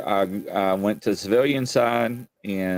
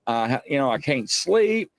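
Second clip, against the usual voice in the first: frantic caller; 7 semitones higher than usual. Retold or imitated. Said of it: "they would call me up like".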